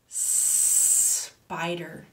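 SPIDER with a long hissed S; long snake sound. Shown as a demonstration. Teacher reads to children, long S. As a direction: stretch the S at the start of spider.

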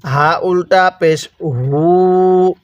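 "হু" held long and slow; slow model reading with "হু" lengthened; stretched vowel. Shown as a demonstration. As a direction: drag the final "হু" out evenly. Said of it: "কিভাবে শিখব".

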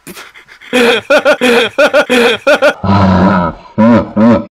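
a long, loud, high laugh; long loud laughter. Real, not acted. Friend laughing at his own tech support joke, long loud laughter. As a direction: keep laughing hard at the joke.

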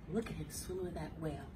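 ordinary delivery, nothing marked

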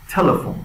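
"تلفن" with a strong puff on the t; explosive T. Shown as a demonstration. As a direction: pop the first t of "تلفن".

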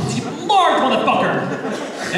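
high, drawn-out relish on the curse; acted barista savoring the swear at last; pent-up outburst. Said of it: "seething in his soul the entire time to just say this".

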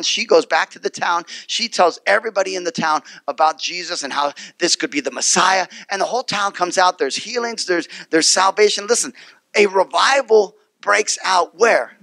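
ordinary talk, nothing marked

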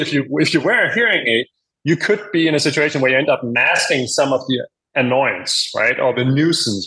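ordinary talk

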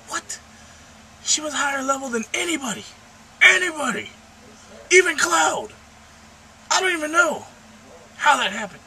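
high, loud indignant rant; outraged disbelief. Retold or imitated. Gamer reliving a character's death, high outraged disbelief. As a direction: protest at the top of your voice, hammed-up. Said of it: "I was like".

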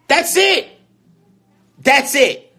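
high-pitched, loud insistence; exasperated protest. Real, not acted. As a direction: throw it out as a helpless protest. Exasperated pleading about having nothing else.

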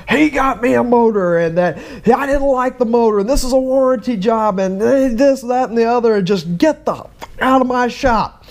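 mild mock rant at customers; retold yelling. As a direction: imitate the junkyard owner ranting. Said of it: "Started yelling and screaming".

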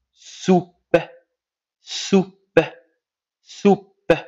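"soupe" high, ending on the p sound; silent final E. Shown as a demonstration. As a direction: model "soupe" slowly with a silent e. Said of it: "la lettre E ne se prononce pas". (« soupe » articulé, e final muet)

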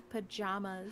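pajamas said slowly as "puh-JAH-muhs"; broad "ah" in the middle of pajamas; disputed pronunciation. Shown as a demonstration. Confirming the odd pronunciation to a friend, slowly. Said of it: "did B say pajamas?".

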